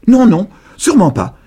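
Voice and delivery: s'écrier d'une voix de vieille très aiguë et forte; exclamation. A narrator's reading. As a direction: protester haut et fort. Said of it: "s'exclama la vieille dame". (la vieille dame proteste vivement, très aiguë et forte)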